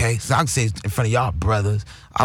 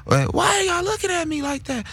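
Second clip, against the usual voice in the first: very high, squeaky greeting. Retold or imitated. Imitating his big cousin's high greeting, very high pitched. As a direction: squeak it like a big man with a tiny voice. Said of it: "but his voice is high".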